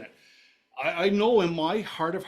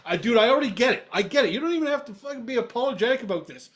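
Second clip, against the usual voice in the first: loud and high, worked up over it; emotional burst of enthusiasm; fired up; 7 semitones higher, 4 dB louder than usual. Real, not acted. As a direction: get louder as the excitement takes over. Excited reply about emotional trip.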